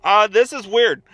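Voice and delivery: laughing loudly in disbelief; baffled laugh. Real, not acted. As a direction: burst out laughing at the car. Car parks oddly.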